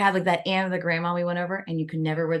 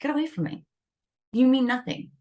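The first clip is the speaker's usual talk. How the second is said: higher, curt mock dismissal of a stranger; dismissive brush-off; 5 semitones higher than usual. Rejecting a stranger's insult, curt and dismissive. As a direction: wave off a stranger who insults you. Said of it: "you'd be like".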